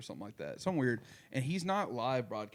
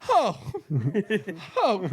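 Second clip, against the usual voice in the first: high, loud wailing oh broken by a laugh; mock anguished cry; 8 semitones higher, 12 dB louder than usual. Mocking the reporter's famous cry, hammed-up high wail. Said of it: "he's just like".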